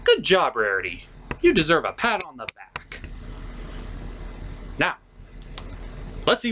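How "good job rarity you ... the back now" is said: ordinary talk, nothing marked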